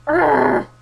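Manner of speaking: loud frustrated urgh; exasperated groan. Real, not acted. Groaning loudly at the shop counter, fed up with the staff.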